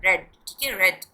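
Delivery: रेड said like "red", short e; रेड with a short e, like bed; past tense pronunciation. Shown as a demonstration. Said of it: "pronounce करेंगे तो आपने इसको हमेशा रेड करना है". (रेड को छोटी "ए" से बोलें)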